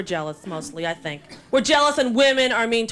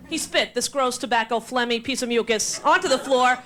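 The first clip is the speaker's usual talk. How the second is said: ordinary talk, nothing marked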